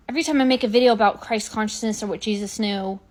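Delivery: normal talking voice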